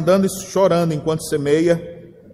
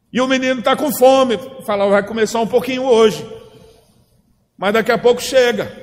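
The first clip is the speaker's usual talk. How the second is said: raised, driving preacher voice; fervent preaching; 5 semitones higher than usual. Acted. Pastor urging the church to keep sowing, fervent preaching. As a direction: preach it with rising urgency.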